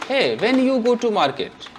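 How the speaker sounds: drawn-out mock sentence; slow wrong example. Shown as a demonstration. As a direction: slowly lay out "when you go to market" as the wrong form. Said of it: "महा गलत, this is wrong".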